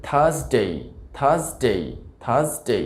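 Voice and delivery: British থার্সডে as "THAHS-day", no r; British pronunciation. Shown as a demonstration. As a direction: say থার্সডে with silent r and gliding "day". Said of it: "British উচ্চারণটা বলি".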